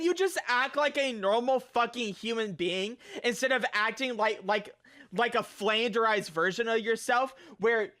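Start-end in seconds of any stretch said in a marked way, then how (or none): none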